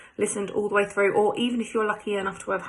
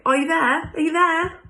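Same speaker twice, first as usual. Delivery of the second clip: loud, high call through the glass; 8 semitones higher, 7 dB louder than usual. Acted. Boy banging on the window, calling out loudly. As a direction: shout to whoever's inside.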